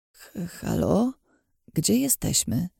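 trembling voice. A narrator's reading. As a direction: barely dare to speak, frightened. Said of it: "Głos mi tak drżał".

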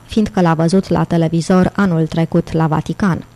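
usual speaking voice

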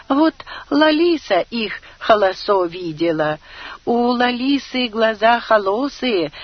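put-on high, l for r, Chinese accent; meek Chinese-accented voice; Chinese accent Russian. A narrator's reading. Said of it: "Китаец".

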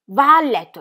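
loud, curt command; stern order. Acted. Ingram ordering Ingeborg to bed, stern. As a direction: send her off sharply.

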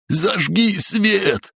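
very high, drawn-out howl; panicked scream. A narrator's reading. Blinded sapper demanding light, screaming. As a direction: howl it high, begging for help. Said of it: "завопил сапёр".

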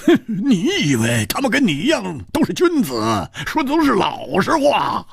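contemptuous, taunting jab; sneering scoff. A narrator's reading. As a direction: scoff at her with a cold laugh. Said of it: "冷笑着说".

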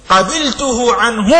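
قبلته عنه said high and slow; teacher stressing the guardian's acceptance; emphatic repeat. Shown as a demonstration. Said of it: "أنا ولي له".

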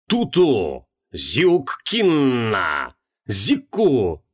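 booming spell names; thundering. Acted. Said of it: "гремел Креол".

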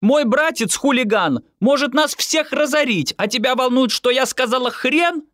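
very high-pitched indignant yelling; outraged tirade, pitch held high; shouting. A narrator's reading. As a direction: rant at the top of the range. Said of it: "закричала Декла".

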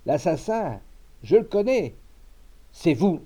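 loud, high accusing cry; shouted accusation. Acted. Narrator accusing the smiling man, shouting. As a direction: hurl the accusation at him. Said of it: "je lui criai".

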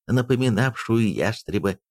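ordinary delivery, nothing marked

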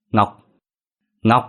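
short, urgent call to his wife; calling her name. A narrator's reading. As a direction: call out to get her attention. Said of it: "Quân gọi tên vợ". (gọi tên vợ)

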